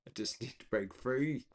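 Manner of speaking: normal talking voice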